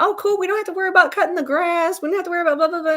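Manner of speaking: high, loud, gushing imitation of her younger self; imitating her past self's bubbly delight; mock naive excitement. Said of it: "I was like".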